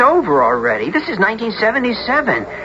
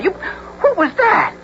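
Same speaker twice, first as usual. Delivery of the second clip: startled; 13 semitones higher, slower than usual. Acted. Something flies past them.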